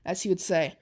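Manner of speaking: usual speaking voice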